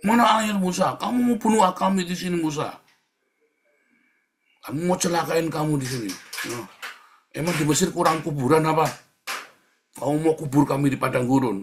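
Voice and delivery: loud, high accusing complaint; hammed-up outraged protest; mock panicked yelling. Preacher plays Israelites blaming Moses in the desert, mock angry yelling. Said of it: "teriak-teriak dengan panik".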